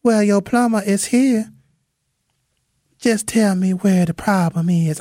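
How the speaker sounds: high, sweet Southern lady greeting; light Southern belle accent. Acted. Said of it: "she had a light Southern accent".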